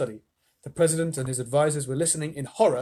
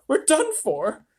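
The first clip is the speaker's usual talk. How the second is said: shouting astronaut; 13 semitones higher than usual. A narrator's reading. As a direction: yell the report. Shanks panicked.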